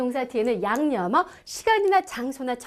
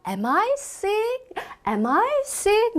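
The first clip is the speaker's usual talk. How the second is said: "Am I sick" very slow and higher; slow model question; 7 semitones higher, much slower than usual. Shown as a demonstration. Patient asking the doctor, very slow question. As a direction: ask it slowly like a worried patient. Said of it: "뭐라 그래요".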